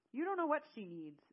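high, loud shout back; angry scream. Acted. Zale screaming back at Eamon, furious and loud. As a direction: scream it back in his face. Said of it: "Zale screamed back at him".

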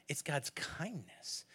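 soft, slow, tender God's kindness; tender emphasis. Real, not acted. Pastor softly stressing the heart of the sermon. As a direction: let it land gently and slowly. Preacher softens on key point.

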